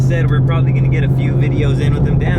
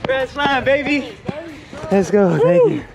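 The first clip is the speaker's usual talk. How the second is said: excited shout; 12 semitones higher, slower than usual. Real, not acted. Celebrates hitting a grand slam.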